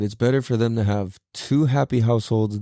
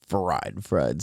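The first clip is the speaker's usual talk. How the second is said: low, deflated "fried, fried", trailing off softer; worn out; 5 semitones lower, 4 dB quieter than usual. Real, not acted. Exhausted podcaster telling listeners he's spent, low and drained. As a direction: let it sink, drained after a long workday. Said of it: "if you hear me sound tired".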